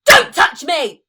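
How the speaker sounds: loud, offended snap; indignant shout. Acted. Famine shouting at Pestilence to keep away. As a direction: shove him off with your voice.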